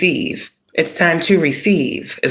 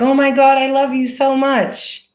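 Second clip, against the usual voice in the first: very high, gushing mock affection; fake gushing friend; 11 semitones higher than usual. Mocking a fake friend who comes in gushing love. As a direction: coo it like a phony admirer. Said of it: "can come in like".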